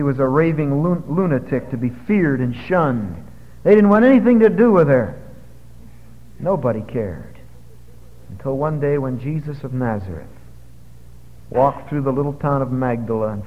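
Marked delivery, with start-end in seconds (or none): none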